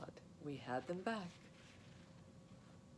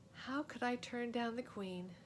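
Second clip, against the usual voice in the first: ordinary delivery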